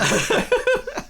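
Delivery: high shrieking burst of laughter; loud high laugh. Real, not acted. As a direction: throw your head back and laugh high. Big laugh at joke.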